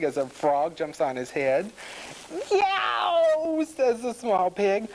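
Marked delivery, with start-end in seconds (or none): 2.5-3.6 high, loud storybook yowl; pained yelp. A narrator's reading. Small pig bitten on the tail, yelping. Turtle bites pig's tail.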